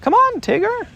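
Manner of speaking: high, loud call upward; calling out Roo. A narrator's reading. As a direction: call up to the tree. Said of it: "he called out".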